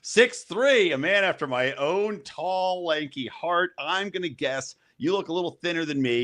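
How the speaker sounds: high, showy fairground barker; carnival barker. Acted. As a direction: call it out like a sideshow barker. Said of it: "I'm gonna sound like a carnival barker here".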